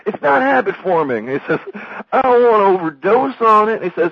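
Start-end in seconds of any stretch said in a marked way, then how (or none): 0.0-1.3 high, loud mock insistence; Sam's retort. Sam answers Dewey's worries about reefer, high loud insistence. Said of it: "and then Sam goes". 2.1-3.8 high, loud naive southerner; Dewey Cox, very naive. Retold or imitated. Dewey worries about reefer's effects, very naive southern voice. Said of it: "like, very naive".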